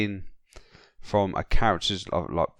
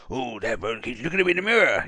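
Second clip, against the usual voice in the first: lightly voiced Tigger, a touch higher; a hint of a jumpy tiger; 4 semitones higher than usual. Friend quoting Tigger in the cab, lightly put-on voice. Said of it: "Tig is like".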